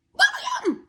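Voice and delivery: name called out high and loud; revealing the surprise culprit, high and loud; excited reveal. Real, not acted. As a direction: announce the name like a shocking twist. Big reveal of who.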